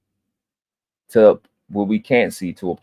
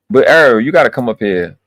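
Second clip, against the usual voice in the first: ordinary delivery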